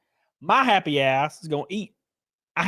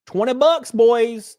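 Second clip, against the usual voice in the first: excited; 9 semitones higher, 6 dB louder, slower than usual. Real, not acted. Hyping up the viewers.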